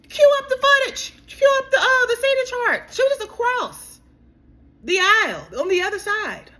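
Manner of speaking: excited demand. Real, not acted. Calling on the show to cue up the footage, high and excited. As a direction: demand it like a producer.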